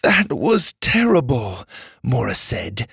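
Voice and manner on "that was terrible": put-on slow, higher girl character voice; relieved. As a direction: let it out slowly, drained after pain. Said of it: "relieved by the darkness of the room".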